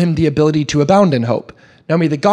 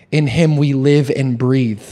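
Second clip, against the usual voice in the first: normal talking voice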